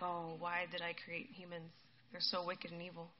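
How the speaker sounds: low, soft, sorrowful sigh of words; grieving regret. Acted. God regrets creating wicked humans, grieving regret. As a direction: lament quietly in a low voice. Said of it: "God grieves for having created humanity".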